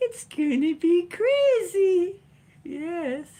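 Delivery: high, slow, gleeful sing-song. Real, not acted. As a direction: relish it like a secret. Gleeful prediction with laugh.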